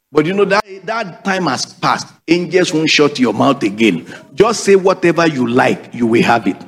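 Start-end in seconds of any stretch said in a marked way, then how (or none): none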